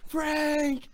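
high, drawn-out calling, volume held back; re-enacted yelling. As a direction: call a name out the window, mock yell. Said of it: "and just yelling".

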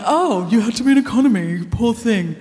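mock-pitying sneer, swooping "oh"; sarcastic pity. Comedian voices audience hating her for flying business, sarcastic pity. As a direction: imitate resentful listeners feigning sympathy. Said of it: "Some people are hating me, going".

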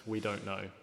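put-on gruff, terse northern accent. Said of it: "replied with a gruff tone in a northern accent".

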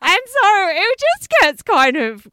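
really worked up, pitch high; excited exclamation. Real, not acted. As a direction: belt it out over the laughter. Excited reaction to her own story.

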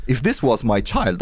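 usual speaking voice

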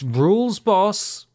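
big, high, slow reveal; theatrical fanfare delivery; hyped announcement. Pretend. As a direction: herald a grand comeback, loud and drawn out. Hyping a returning show.

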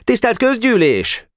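high, strident accusing speech; pompous young accuser; boy orator. A narrator's reading. Boy's accusing speech to meeting.

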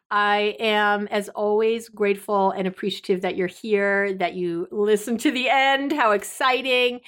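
ordinary talk

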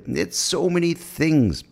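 ordinary talk

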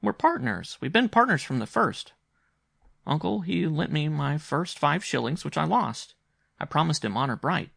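put-on high-pitched, insistent child voice; earnest young boy. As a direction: confide in uncle as an eager boy. Boy explains his secret partnership.

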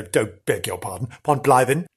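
ordinary talk, nothing marked

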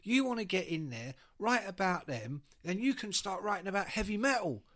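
pushy Cockney phone caller; Cockney lunatic. Acted. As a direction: press the point like a Cockney chancer. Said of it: "this kind of Cockney lunatic".

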